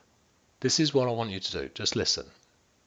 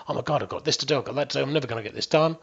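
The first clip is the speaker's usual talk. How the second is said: rushed, hurried stressed chatter; stressed fast talk; 2 semitones higher, much faster than usual. Retold or imitated. Coach imitating someone rushing inside their head, fast. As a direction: rattle it off in a panic. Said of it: "really stressed like this".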